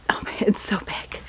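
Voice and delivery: hushed whisper about the size; awed little whisper. Real, not acted. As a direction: whisper it like a secret. Whispering about huge book.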